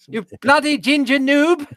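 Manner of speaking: high-pitched mock-regal insult; trash-talk in a squeaky put-on Queen voice; hammed-up gamer Queen, way up high; the Queen gaming. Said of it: "Can you see the Queen sitting".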